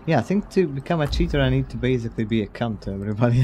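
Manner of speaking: ordinary delivery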